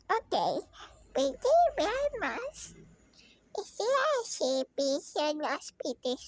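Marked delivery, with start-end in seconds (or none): none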